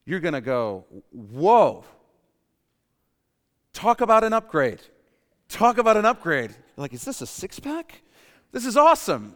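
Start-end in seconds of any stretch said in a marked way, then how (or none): 1.1-6.5 loud, high amazed exclamation; awed excitement. Acted. Preacher voices the newly dead seeing heaven, awed excitement. Acting out waking up after death. 6.9-8.0 high-pitched amazed question; delighted disbelief. Acted. Preacher voices someone finding a new heavenly body, delighted disbelief. 8.5-9.3 loud, high burst of delight; thrilled. Acted. Preacher voices someone delighted by life after death, thrilled.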